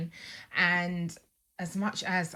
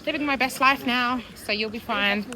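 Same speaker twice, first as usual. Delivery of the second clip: higher, bright cheerful pep talk; upbeat encouragement; 7 semitones higher than usual. Retold or imitated. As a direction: lift her spirits, sound proud and upbeat. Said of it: "said to her".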